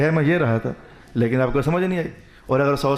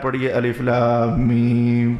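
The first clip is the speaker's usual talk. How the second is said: slow, drawn-out reading of the letters; slow recitation; 6 semitones lower, much slower than usual. Retold or imitated. Jibril telling the Prophet to recite, slow drawn-out letters. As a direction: lay out each letter slowly, lower.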